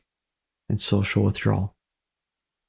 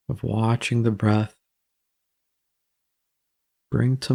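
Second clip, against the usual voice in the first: calm, even meditation voice with long pauses; guided meditation. Acted. Guiding listeners to watch their breath, calm meditation delivery. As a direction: ease the listener into the breath, unhurried.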